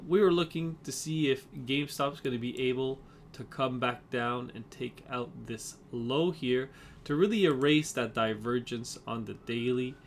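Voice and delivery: ordinary talk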